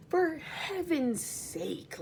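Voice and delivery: slow, high exasperated sigh. Acted. Earthworm complains about the centipede's boots, exasperated. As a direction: drag it out, fed up.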